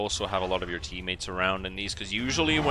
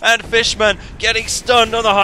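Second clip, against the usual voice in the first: high, loud climax of a match call; excited play-by-play; 12 semitones higher, 10 dB louder than usual. Real, not acted. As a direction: build to a shouted commentary peak. Caster calls a sudden stun.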